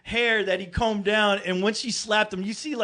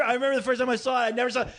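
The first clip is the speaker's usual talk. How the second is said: ordinary talk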